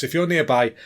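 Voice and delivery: usual speaking voice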